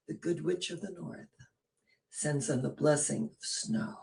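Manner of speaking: normal talking voice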